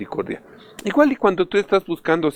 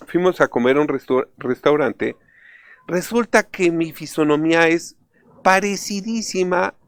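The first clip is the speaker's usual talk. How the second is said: ordinary delivery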